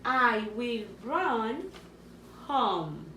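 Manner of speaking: slow, deliberate dictation of a sentence; teacher dictating slowly; slow dictation read. Shown as a demonstration. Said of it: "Let's start with the first one".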